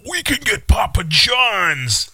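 high, drawn-out gleeful call; hammed-up party enthusiasm; mock college-kid excitement. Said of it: "Am I channeling, like, your college years".

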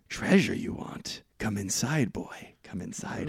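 unvoiced rasping hag, soft and slow; a creaky monster whisper luring the boy inside; creepy hag voice. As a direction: lure the boy in like a whispering crone. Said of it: "she's like".

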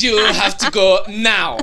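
loud, slow mock-German order; German accent. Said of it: "That sounds German".

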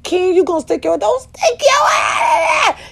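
high frantic cry; panicked warning. Retold or imitated. Warning Ken not to touch the box, frantic high cry. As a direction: shriek it high to stop him. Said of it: "I said".

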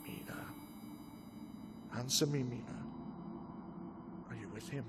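soft hypnotic questioning. Acted. Hypnotist questions entranced Mina softly.

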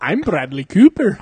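slow, loud bad Scottish accent, laughing; botched Scottish accent. Actor introducing himself in the booth, botched Scottish accent. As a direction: try a Scottish accent and fail comically. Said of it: "how to do a Scottish accent".

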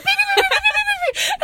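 very high, rapid beep-beep horn noise; frantic horn beeping. Retold or imitated. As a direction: imitate a car horn in a panic. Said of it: "I went".

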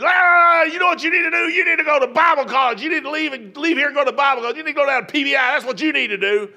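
high, loud tirade opening on a roar; yelling and screaming. Retold or imitated. Mad pastor to church member after prayer, imitated screaming rant. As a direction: imitate an angry man hollering. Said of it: "ready to yell and scream at me".

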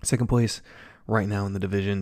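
ordinary talk, nothing marked

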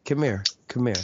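smooth radio-DJ menace; a hint of the calm DJ threat; calm DJ-voiced threat. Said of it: "so calm, like a radio D J".

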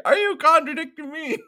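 high, loud mock outrage; hammed-up drill-sergeant challenge; outraged sergeant. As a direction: roar it at an insolent recruit.

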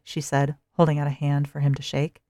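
ordinary delivery, nothing marked

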